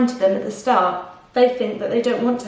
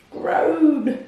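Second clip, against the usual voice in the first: high falling groan; groan of pain; 6 semitones higher than usual. Acted. Crocodile lying on his back in pain, groaning. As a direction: moan like a crocodile with toothache. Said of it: "he was crying real tears".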